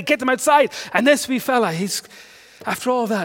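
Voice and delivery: ordinary delivery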